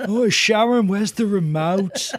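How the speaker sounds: put-on a dazed old rocker asking; [name] voice. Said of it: "with a little bit of Brooklyn thrown in".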